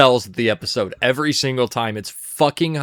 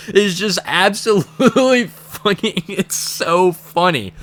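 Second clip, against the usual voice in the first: laughing; 5 semitones higher, slower than usual. Real, not acted. Laughing at the joke.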